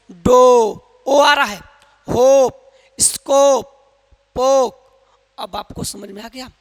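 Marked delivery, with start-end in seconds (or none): 0.1-4.8 each word called out loud and clear, like a teacher drilling; loud drilled words. Shown as a demonstration. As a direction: call out every word loudly for the class. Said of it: "अब यहाँ पर इसको देखिए".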